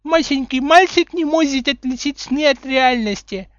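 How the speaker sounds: put-on high, loud simpering baby voice; put-on toddler lisp, loud and high; mocking baby talk. A narrator's reading. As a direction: taunt in squeaky baby talk. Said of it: "кривляясь".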